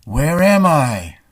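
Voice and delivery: slow, loud, altered voice lost in static; odd radio voice. Acted. Storyteller playing a lost voice after signal trouble, slow and loud. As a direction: drawl it out as if lost in space.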